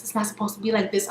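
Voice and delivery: Ray J repeating it softly. Retold or imitated. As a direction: say it under your breath, quickly. Repeat, half voice.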